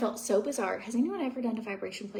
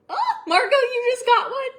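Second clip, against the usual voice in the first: excited call out; 13 dB louder than usual. Real, not acted. Teasing friend Margot about her new plate, loud excited call. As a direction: sing out her name, delighted.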